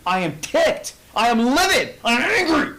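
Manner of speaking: rising from firm to a high, loud furious cry; mock royal fury that swells to a shout; building anger. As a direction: let the king's rage climb with each phrase. King's furious decree retold.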